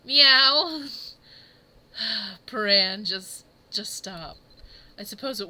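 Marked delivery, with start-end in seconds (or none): 0.0-0.9 a loud, high meow; a cat meow, pitched up and loud; cat impression. Showing what the cat impression should have been, loud high meow. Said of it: "supposed to be a cat impression".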